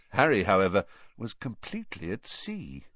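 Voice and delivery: ordinary delivery, nothing marked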